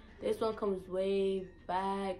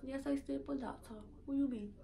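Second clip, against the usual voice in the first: higher, softer Saint Thomas reply; Virgin Islands accent; 4 semitones higher, 8 dB quieter than usual. Retold or imitated. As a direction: answer back like an islander. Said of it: "They be like".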